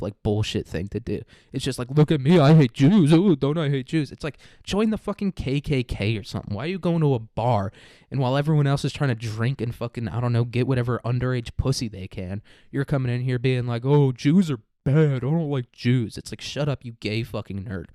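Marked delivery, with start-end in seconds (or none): 1.9-4.1 much louder, attention-seeking boast; mock showoff. Parodying attention-seeking bar guy. 13.8-16.2 slow, dopey exaggerated drawl; mock dumb. Parodying bar guy.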